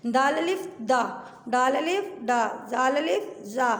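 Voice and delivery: "दाल अलिफ दा" read slowly, letter by letter; sounding out letters. Shown as a demonstration. Teacher drills the letters with alif for pupils, slow. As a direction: drill each syllable aloud. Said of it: "पढ़ के भी बता देती हूँ".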